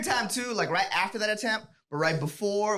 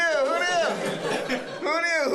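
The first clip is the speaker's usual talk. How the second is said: higher, laughing who knew; mock surprise; 8 semitones higher, much slower than usual. Shown as a demonstration. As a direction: feign wide-eyed surprise.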